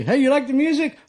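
mock upbeat driver voice; high mock voice. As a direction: play the odd driver with a high bright squeak of a question. Driver quoted asking about music.

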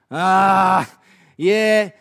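sceptical heckler. Pretend.

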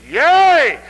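loud, high, ringing shout; exultant preacher's cry. Acted. Acts a preacher crying yea.

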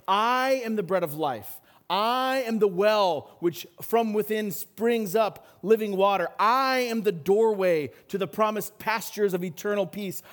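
ringing proclamation, "I" held long; emphatic declaration. Retold or imitated. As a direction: stress and stretch each opening "I". Jesus's "I am" sayings, preached emphatically.

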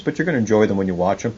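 usual speaking voice

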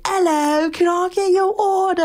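high, bright put-on British accent, playfully hammed up; fake-posh British order-taker voice on the intercom; mock British accent taking a drive-thru order; crazy British accent. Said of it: "like, British accent".

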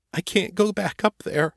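put-on a terrified kid pleading not to go back; small pleading voice. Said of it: "in a small, pleading voice".

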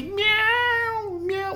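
high, tiny mewing cat voice; imitating a cat meow. Husband mimics the cat crying from the wall, high mew.

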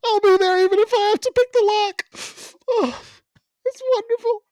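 high, loud hammed-up weeping falsetto; mock sobbing. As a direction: overplay a tearful movie farewell for laughs. Mock weepy movie character.

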